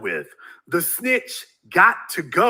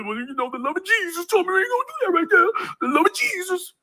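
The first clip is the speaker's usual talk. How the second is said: much higher, fast, whiny crying church voice; mocking pious believer; 12 semitones higher, 3 dB quieter, faster than usual. Mocking a timid churchgoer in the chat, high whiny crying voice. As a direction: whine it like a mock-crying Sunday school type.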